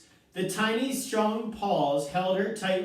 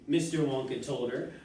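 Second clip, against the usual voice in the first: ordinary delivery, nothing marked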